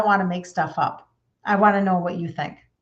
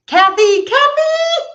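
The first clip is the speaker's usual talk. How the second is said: much higher, louder squeal of recognition; excited shout; 9 dB louder, much slower than usual. Real, not acted. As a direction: squeal the name with delight. Recognizes a viewer's name.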